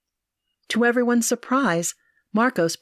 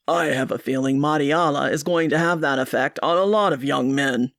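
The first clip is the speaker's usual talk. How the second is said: put-on lower, fond older father; father teasing about the baby, voiced lower; father's voice; 5 semitones lower than usual. A narrator's reading. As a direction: deepen into the dad, gently joking.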